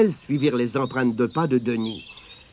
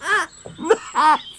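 burst of laughter; 12 semitones higher than usual. Acted. Tirondin and Abigail laugh at robe.

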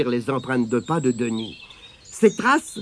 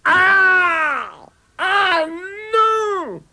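high, shrill-pitched shriek of horror; horrified cry; much slower than usual. Acted. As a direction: cry out in sudden terror. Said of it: "poussa un cri horrifié". (cri d'horreur aigu)

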